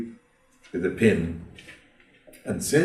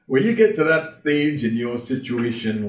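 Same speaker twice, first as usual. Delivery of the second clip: normal talking voice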